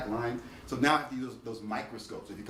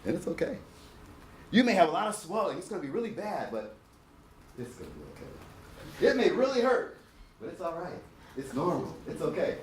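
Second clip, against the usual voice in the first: gentle, comforting bedside voice; soothing reassurance; 6 semitones higher than usual. Shown as a demonstration. Dentist showing students how to reassure a patient, soothing. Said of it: "Watch this".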